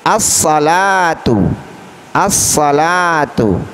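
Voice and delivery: slow "As-salatu", doubled s and long la; al not read. Shown as a demonstration. Teacher models the example twice, "As-salatu" without l. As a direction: hold the s and stretch la in "As-salatu". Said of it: "Alnya tidak boleh dibaca dengan jelas".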